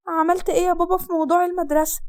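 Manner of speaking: put-on light girlish voice, curious; girl's voice. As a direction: daughter asking her father about school. Daughter Hasnaa voiced.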